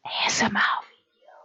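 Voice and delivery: voiceless, intimate whisper; ASMR whisper. Real, not acted. Streamer offering viewers an ASMR video, whispering. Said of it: "need to whisper".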